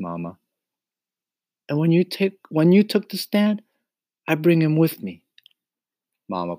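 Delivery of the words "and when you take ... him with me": put-on high-pitched, excited boyish plea; pleading boy Mario. A narrator's reading. Mario begs Mama to let the cricket stay, pleading.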